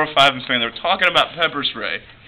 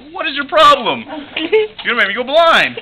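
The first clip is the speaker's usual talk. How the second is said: high, indignant complaint; a hammed-up whiny protest; mock annoyance; 10 semitones higher than usual. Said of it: "I'm like".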